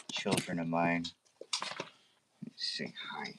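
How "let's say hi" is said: soft hushed greeting to the dogs; whisper. Real, not acted. Owner greeting her puppies in a hushed whisper. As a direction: breathe it out, barely voiced.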